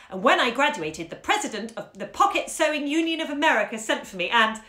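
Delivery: student spinning tall tales, mock earnest; mock-serious rattling. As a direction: rattle it off dead serious and quick. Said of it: "rattle off nonsense with an intensely serious face and machine gun speed".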